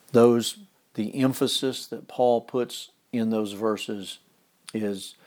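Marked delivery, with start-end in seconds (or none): none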